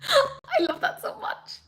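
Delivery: choked up with happy tears; real emotional crack; tearful joy. Real, not acted. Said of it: "really weirdly emotional".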